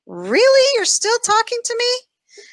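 high, loud, incredulous "Really?"; mock disbelief. Answering her inner critic in the mirror, high and incredulous. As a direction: throw it back at the voice in high disbelief. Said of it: "I'm like".

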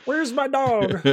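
squeaky little Martian asking where it went; Marvin the Martian. Retold or imitated. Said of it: "a little bit of a Marvin Martian".

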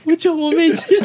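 blurted through loud laughter; can barely get the words out laughing. Real, not acted. Retelling the sister-signing story, cracking up.

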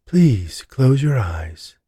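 very slow, drawn-out instruction; slow medium voice. Acted. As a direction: stretch each word like a mystic. Medium leading a seance.

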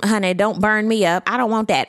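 usual speaking voice

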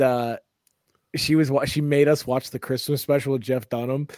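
ordinary delivery

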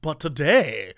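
storybook narrator. Acted.

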